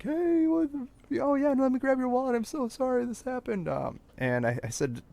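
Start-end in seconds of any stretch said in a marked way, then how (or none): 0.0-3.7 very high, sweet, overly friendly voice; super nice police staff. Retold or imitated. Friendly police clerk handing back his wallet, sweet high apology. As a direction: gush apologetically like the nicest clerk alive. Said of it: "the two nicest people in the world are like".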